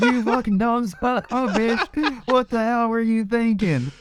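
hammed-up laughing tirade; laughing mock insult. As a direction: imitate angry workers through laughter. Friend mimics workers laughing.

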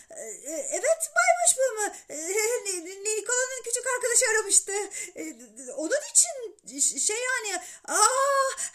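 flustered, stammering high-pitched politeness; suddenly polite. A narrator's reading. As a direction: grovel to the boss after yelling at him. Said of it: "Sonra nazik ve yumuşakça". (telaşla kekeleyen, aşırı nazik ses)

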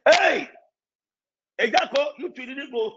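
ordinary talk, nothing marked

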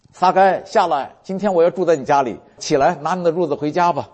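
high, loud call up into the tree. Acted. Jesus calls Zacchaeus down. (高声呼唤)

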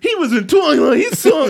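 high, loud, shocked yell; outraged fan shout. Acted.

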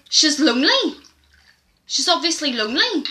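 old man accusing the owner, mock near-shout; imitated near-shout. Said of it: "started nearly shouting at me".

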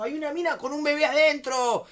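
high, loud frantic outburst; pánico imitado. Retold or imitated. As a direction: blurt it out as the panicked friend. Ingeniero desencajado, le piden calma. (imitando un grito desesperado)

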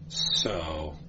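soft, low murmured "so". A narrator's reading. Inquisitor to the prisoner, a low murmur. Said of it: "murmured the Inquisitor".

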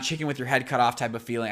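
ordinary delivery

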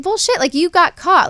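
high, loud indignant retort. Retold or imitated. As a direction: call him out hotly. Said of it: "I'm like".

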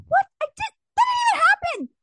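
very high incredulous squeal; imitating own outraged astonishment, way up high; retold disbelief. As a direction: squeak out exaggerated, mock-shocked protest. Retelling her disbelief at a comment.

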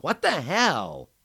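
horrified at his wounds; dismayed friend checking his nose; stunned outrage. A narrator's reading. Sees his injuries.

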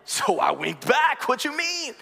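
drawn-out line climbing into a high cry; hammed-up storyteller hype, pitch soaring. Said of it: "they're going higher and higher".

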